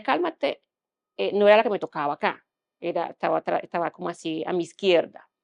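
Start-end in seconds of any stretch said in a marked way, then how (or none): 0.0-0.6 Cálmate jumps high and firm, mimicking the nurse; nurse's firm calmate. Nurse ordering her patient, high and firm. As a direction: call out Cálmate sharply, pitched up. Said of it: "pero la que me decía".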